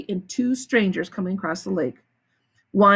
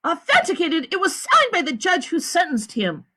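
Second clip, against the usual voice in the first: lawyer's voice; 9 semitones higher than usual. A narrator's reading. The lawyer promises Stanley, lightly voiced and higher. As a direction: reassure the boy firmly.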